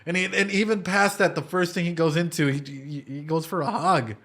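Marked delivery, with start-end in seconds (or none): none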